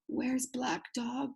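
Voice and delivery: soft, slow mumble; sick man's slow, soft mumble; mumbled. Acted. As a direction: mumble it weakly, half awake. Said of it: "he mumbled".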